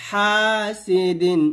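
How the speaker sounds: ordinary delivery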